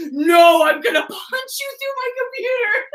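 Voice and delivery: loud, high mock threat dissolving into laughter; hammed-up fury, laughing; playfully threatening a YouTuber; mock outburst. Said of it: "I'm like".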